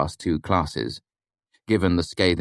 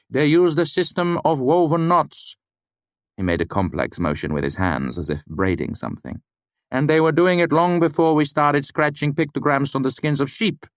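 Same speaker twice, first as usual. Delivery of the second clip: higher, deadpan teacher voice; mock-serious lecturer; 10 semitones higher, 3 dB louder than usual. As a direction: deliver the joke straight-faced. Lecturer corrects student.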